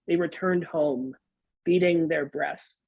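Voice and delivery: usual speaking voice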